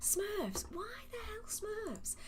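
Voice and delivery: very high, incredulous squeak; baffled disbelief. Real, not acted. Host speechless over Smurfs being banned, high incredulous questioning. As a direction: pitch leaps up, softly, in sheer bafflement.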